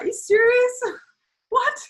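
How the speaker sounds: high, loud disbelieving shriek that breaks into a laugh; incredulous shriek. Retold or imitated. Disbelieving partner shrieking at him over his fever, then laughing. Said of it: "I'm just like".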